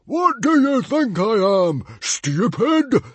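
high, loud angry challenge; bellow. Acted. Chief rejecting his son's excuse, bellowing. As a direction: bellow it in disbelief. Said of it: "bellowed Stoic".

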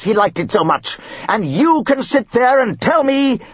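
hammed-up outraged snob; ranting father. Father defending his old school, ranting.

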